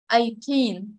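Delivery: put-on Eighteen with TEEN stressed; eigh-TEEN, second syllable prominent. Shown as a demonstration. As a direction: put the weight on the end of Eighteen. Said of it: "nhấn trọng âm số hai".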